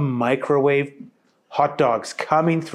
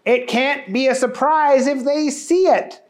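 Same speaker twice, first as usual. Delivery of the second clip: higher goofy protest; Booger voice; 11 semitones higher than usual. Acted. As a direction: argue like a silly child.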